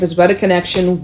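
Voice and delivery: usual speaking voice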